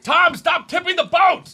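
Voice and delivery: high, loud rowing shout; shouted at teammate. Pretend. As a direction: yell across the boat. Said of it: "And where they're like".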